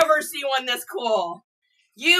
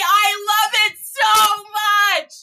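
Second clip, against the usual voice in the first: ordinary talk, nothing marked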